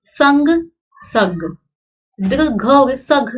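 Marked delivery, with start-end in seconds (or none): 0.1-0.5 संग with a nasal n, higher and louder; with tippi. Shown as a demonstration. Teacher shows संग with tippi, nasal. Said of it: "अंतर पड़ गया". 1.0-1.4 सग with no nasal, lower; सग said bare; without tippi. Shown as a demonstration. Teacher shows सग without tippi, no nasal. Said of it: "अंतर पड़ गया".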